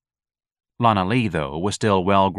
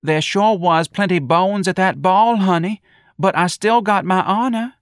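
hopeless sigh; 11 semitones higher, 5 dB louder than usual. Acted. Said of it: "sighed hopelessly and said".

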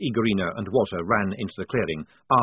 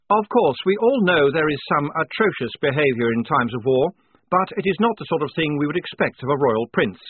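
higher, louder formal lieutenant; indignant officer; 6 semitones higher, 5 dB louder than usual. A narrator's reading. Lieutenant lecturing the prisoner on princely conduct, formal and indignant. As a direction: pronounce judgement with earnest propriety.

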